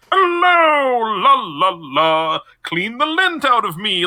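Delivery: high, loud, jaunty gentleman's greeting; a silly sing-song belly-button voice. Retold or imitated. Retelling Jerry's belly button voice, high jaunty sing-song. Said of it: "where it's like".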